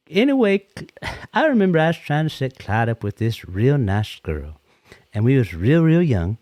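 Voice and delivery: usual speaking voice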